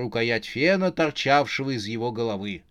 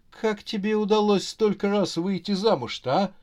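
high, daunted questioning; lightly mumbled question, pitched up; mumbling; 6 semitones higher than usual. Acted. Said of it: "промямлил Марк". (растерянно, высоким голосом)

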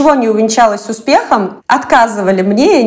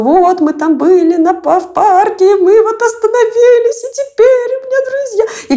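hyperventilating; 9 semitones higher than usual. Retold or imitated. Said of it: "сквозь слёзы и всё прочее рассказывать начала".

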